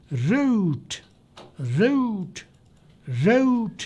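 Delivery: रूट with a rounded "ra-oot" glide, said slowly; native root demo. Shown as a demonstration. Teacher modelling रूट for the class, rounded glide. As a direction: say रूट the way a native speaker does, softly. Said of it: "लेकिन वो रूट नहीं बोल रहा".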